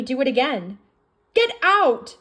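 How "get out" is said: high, loud shout; frightened shout, much higher. Acted. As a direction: yell it to drive her away. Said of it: "she shouted".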